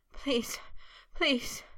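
breathless chanted begging; panting plea. A narrator's reading. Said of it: "he panted in a chanting plea".

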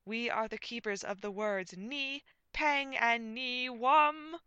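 loud, high, slow proclamation; grand comic declaration climbing in pitch; pompous knight. A narrator's reading. Knight proclaims the sacred words.

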